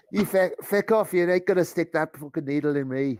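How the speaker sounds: loud, high-pitched Irish outburst; Irish accent. Acted. Jimmy refusing the nurse's needle, loud Irish accent. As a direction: shout it in indignant Irish. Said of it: "he says with a rather Irish accent".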